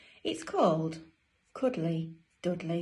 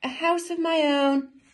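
high, louder storybook delight; delighted penguin; 9 semitones higher, 6 dB louder than usual. Acted. Dudley alone once more, high pleased exclamation.

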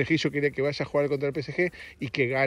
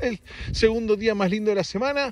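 much higher, slow, hammed-up wide-awake voice; mock wide awake; 8 semitones higher than usual. Host on the podcast insisting he is wide awake. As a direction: overplay being fully awake. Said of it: "Estoy muy despierto".

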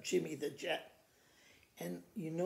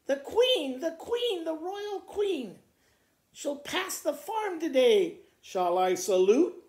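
much higher, loud, frantic farmer; flustered farmer; 7 semitones higher, 8 dB louder than usual. Acted. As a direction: squeak out the queen's coming in panic. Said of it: "he asked the horse".

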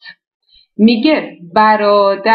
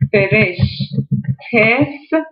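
slow demo; much slower than usual. Shown as a demonstration. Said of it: "یک جوری دیگه هم براتون بگم".

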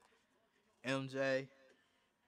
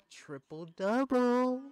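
much higher, drawn-out celebratory yell; excited shout; 13 semitones higher, 14 dB louder, slower than usual. Real, not acted. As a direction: shout it long and high in triumph. Celebrates a stat in game.

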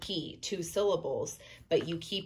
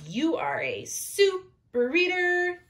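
high, loud super reader cheer; excited praise; 12 semitones higher, 4 dB louder, slower than usual. Real, not acted. Teacher praising each child as a super reader, high and loud. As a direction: make them feel proud.

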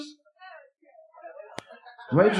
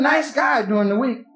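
normal talking voice

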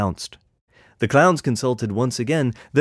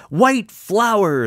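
high, bright gleeful shout of the clowns; 10 semitones higher, 4 dB louder than usual. A narrator's reading. Clowns joyfully misheard chant, gleeful shout. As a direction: shout it out with glee. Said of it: "the clowns shouted".

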